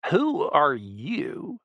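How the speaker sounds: slow, drawn-out rising bayou snarl; snarled question. A narrator's reading. Ringleader snarling at his captive, slow and drawling. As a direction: drag it out like a menacing bayou boss. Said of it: "he snarled".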